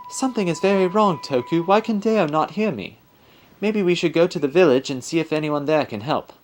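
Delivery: high, anxious character voice; put-on high voice, puzzled and troubled; high-voiced spirit, worried. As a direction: fret to a young companion in a high voice. Game character worries to Toku.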